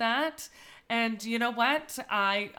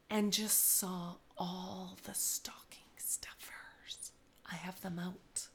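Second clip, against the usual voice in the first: whispering; 3 semitones lower, 10 dB quieter, slower than usual. Real, not acted. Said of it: "Why have to whisper?".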